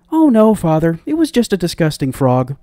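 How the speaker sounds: high, loud princess voice; high-voiced daughter reassuring her father; dismissive princess.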